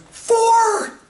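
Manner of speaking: very high, loud shout of triumph; hammed-up jackpot shout; excited shout. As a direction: yell it like you just hit the jackpot. Ad character shouting win.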